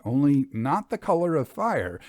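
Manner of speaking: plain reading voice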